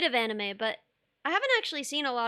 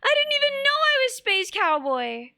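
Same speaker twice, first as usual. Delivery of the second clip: squeaky, much higher stunned gasp of a voice; slow high-pitched disbelief, words stretched wide; stunned Spike; 13 semitones higher, 5 dB louder, slower than usual. Retold or imitated. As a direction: play the dumbfounded hero who just learned his own title. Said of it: "and you're like".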